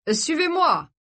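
high, bright party call to the guests; excited host call; exclaiming. Acted. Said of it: "s'écria-t-il".

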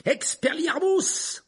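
high, loud, drawn-out battle cry; exclaimed spell. A narrator's reading. Boy casts a disarming spell, shouting. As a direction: shout the spell with full force. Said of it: "s'exclama-t-il".

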